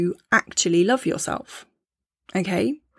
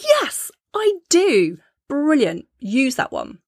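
much higher, slow and thrilled; delighted yes; 5 semitones higher, slower than usual. Retold or imitated. Listener delighted by the affirmation, high and thrilled. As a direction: cheer the affirmation on as if it is a gift. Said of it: "maybe you're like".

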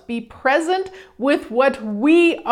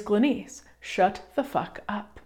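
quoted blunt hush; 7 dB quieter than usual. Retold or imitated. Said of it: "Gary Douglas has gifted me".

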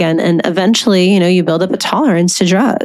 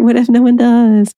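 raised-pitch voice of self-doubt; little inner voice; 4 semitones higher than usual. Acted. Inner doubt asking about future clients, little voice. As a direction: play the worrying little voice, pitched up. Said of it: "that little voice pop up".

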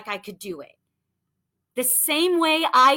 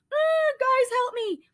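mock helpless plea. Mock panicked plea to team.

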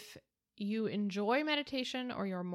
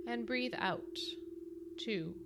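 slow breath counting; slower than usual. Acted. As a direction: count the breaths calmly and slowly. Leads five breaths.

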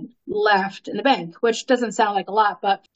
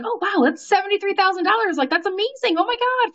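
high, thrilled squeal of disbelief; retold excited freakout; 8 semitones higher than usual. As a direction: gush like you just won big. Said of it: "freaking out, blah, blah, blah".

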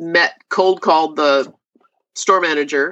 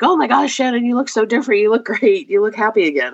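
delighted friend; 6 semitones higher than usual. Retold or imitated. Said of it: "she's like".

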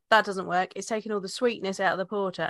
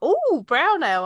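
high, slow, delighted exclamation; excited ooh; 7 semitones higher, 7 dB louder, slower than usual. Retold or imitated. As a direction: gush over it, drawn out. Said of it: "and then I was like".

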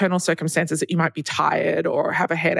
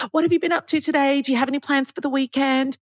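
perky sales assistant; 7 semitones higher, faster than usual. Retold or imitated. Sales assistant keeps questioning.

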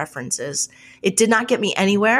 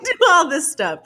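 usual speaking voice

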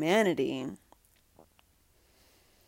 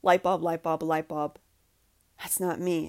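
stunned realization; 5 dB louder than usual. Retold or imitated. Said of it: "you're like".

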